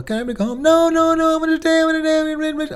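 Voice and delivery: put-on high, nagging child refusing to leave; whining child. Child at playground whining to parent, high and stubborn. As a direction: whine like a kid who won't go home. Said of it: "They just used whining".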